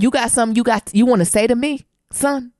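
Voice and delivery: mock-stern dad daring his son; confrontational dad. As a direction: challenge him with a rising, pointed question. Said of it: "he said ... you know how you yoke him up".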